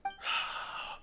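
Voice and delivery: soft, airy sigh of defeat; resigned sigh. Acted. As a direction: let out a quiet, giving-in breath. Gives in to questioning.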